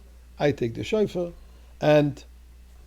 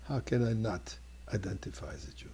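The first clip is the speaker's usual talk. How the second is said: a hushed whisper; 3 semitones lower, 7 dB quieter than usual. Retold or imitated. Guard's quiet confession.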